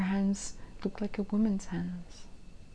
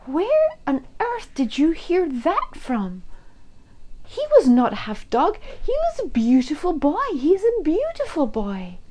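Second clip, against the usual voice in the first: 1 indignant; 8 semitones higher, 8 dB louder than usual. A narrator's reading. Mother protests the rumour.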